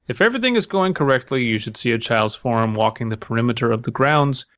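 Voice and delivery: ordinary delivery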